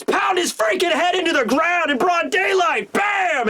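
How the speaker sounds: high-pitched battle yell; explosive shout. Acted. Sanji deciding to pile drive a dude, explosive high shout. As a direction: burst into a hyped-up fighting roar.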